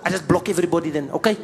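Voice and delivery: put-on lightly accented nervous purser; hint of a South African purser; flight attendant. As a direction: fuss over the celebrity. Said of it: "And then I said".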